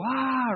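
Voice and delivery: a mild worried sigh of stress; little stressed ah. Shown as a demonstration. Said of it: "We get a little".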